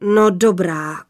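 slow, heavy, resigned rumble; grudging, weighty assent; sighing giant. A narrator's reading. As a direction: sigh it out as a huge father. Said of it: "vzdychl hrozitánský hlas".

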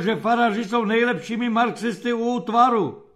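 high, loud, self-cursing rant; angry grumbling. A narrator's reading. Officer cursing himself for sending out the priests, angry rant. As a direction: rail at your own stupidity, angrily. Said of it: "zahučel zlostně".

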